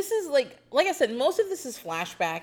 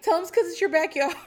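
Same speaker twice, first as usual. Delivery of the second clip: imitating Jessica; 10 semitones higher, 5 dB louder, faster than usual. Jessica advising, quick high voice.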